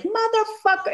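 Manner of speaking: a high mock Sam Jackson punchline. Sam Jackson voice answering the girls' request for a joke, high mock delivery. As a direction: toss off the swear like the voice assistant. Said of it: "And he's like".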